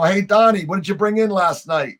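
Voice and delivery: a cop teasingly hailing a known smuggler; calling out. Retold or imitated. Cops calling across to Donnie.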